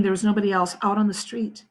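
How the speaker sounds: ordinary delivery, nothing marked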